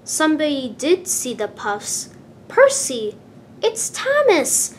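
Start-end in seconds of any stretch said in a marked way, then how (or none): 2.5-4.8 much higher, louder excited call. A narrator's reading. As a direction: call out in delight at spotting a friend. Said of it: "Percy peeped excitedly".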